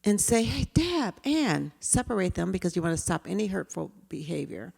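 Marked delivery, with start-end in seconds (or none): 0.4-1.7 calm gentle call. Shown as a demonstration. Teacher gently calling two fighting kids, calm. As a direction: call the kids over calmly, no alarm. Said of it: "approach very calmly".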